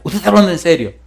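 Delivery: retold excitement. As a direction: imitate someone excitedly checking it's serious. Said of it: "me dice él emocionado".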